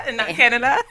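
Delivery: high, loud laughing tease; big laugh around a teasing quip; laughing loudly. Real, not acted. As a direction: laugh through the joke at full volume. Teasing about Trinidad schooling.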